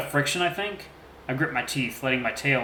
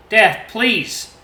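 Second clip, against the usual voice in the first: high, louder begging cry; desperate plea; 9 semitones higher, 8 dB louder than usual. A narrator's reading. Son begs his armed father, desperate high plea. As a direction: beg Dad to stop.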